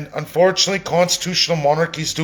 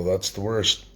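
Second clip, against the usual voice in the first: hoarse, throat catching after coughing; rough throat, frog in the throat; 6 semitones lower, 4 dB quieter than usual. Real, not acted. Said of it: "Totally got a frog in the throat".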